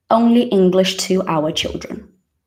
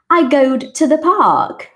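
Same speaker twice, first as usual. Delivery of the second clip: put-on higher, louder, slow little-girl voice; imitating her daughter; 7 semitones higher, 4 dB louder than usual. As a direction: play a four-year-old, high and slow. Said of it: "she says something like".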